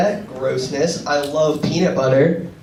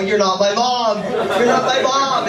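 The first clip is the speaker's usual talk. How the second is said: slightly raised, slowed mock yell; 6 semitones higher, 3 dB louder, slower than usual. Kid yelling at passersby in the store, mock yell. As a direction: yell it out to the shoppers, half-playing. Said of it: "I would just yell".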